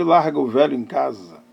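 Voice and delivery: ordinary delivery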